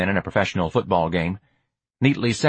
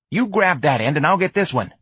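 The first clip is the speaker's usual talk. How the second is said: put-on higher street-kid voice; pitched up, casual and practical; streetwise young hustler, brisk; 9 semitones higher than usual. George organizes lifting the wagon, brisk street voice.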